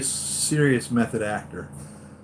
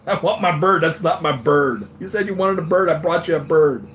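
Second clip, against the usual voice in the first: loud, higher-pitched acted argument; mock quarrel; 6 semitones higher, 6 dB louder than usual. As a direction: argue it out loudly, playing both sides. Acting out film argument over bird.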